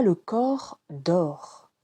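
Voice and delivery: ordinary talk, nothing marked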